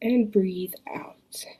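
slow breathy whisper; soft spa whisper. Acted. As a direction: whisper it out slowly. Whispered exhale cue.

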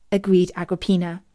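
ordinary delivery, nothing marked

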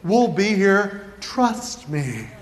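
low, slow coaxing; mock reassurance. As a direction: imitate a big kid smoothly talking a little one into it. Big kids coaxing toddler into stunt.

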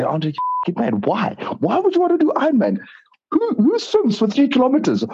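high, playful ribbing; mock teasing. Teasing her husband about doing Ironman, high mock disbelief. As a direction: tease him like a disbelieving friend. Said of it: "I always used to tease him".

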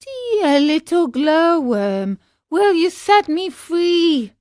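high, slow marionette voice; high-pitched Pinocchio-style plea; pleading puppet. A narrator's reading. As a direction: call out pleadingly in a high little voice. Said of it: "He called to her and said".

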